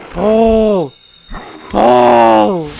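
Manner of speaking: loud yell. Real, not acted. Player cheering on the game's rescue, loud yell. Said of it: "didn't mean to yell that loud".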